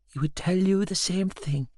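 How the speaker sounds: put-on high, soft old woman's voice; soft, elevated, loving tone; tender great-grandmother. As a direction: gently reassure him. Nanny comforts him, invoking Granda.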